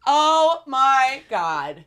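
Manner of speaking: very slow, high and loud "Oh my God"; overwhelmed by the story, stretch it out; moved exclamation. Real, not acted. Moved by the letter.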